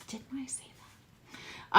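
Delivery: slow unvoiced whisper; whispered aside. Real, not acted. Reader whispering a smug aside to viewers. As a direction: murmur it to yourself, slowly. Aside after card text.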